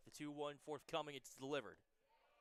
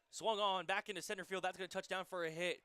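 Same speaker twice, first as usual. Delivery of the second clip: rising, louder call of the hit; excited play-by-play; 4 semitones higher, 7 dB louder than usual. Real, not acted. Live call of a base hit.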